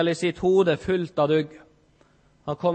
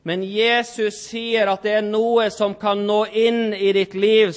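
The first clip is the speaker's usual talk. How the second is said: ordinary delivery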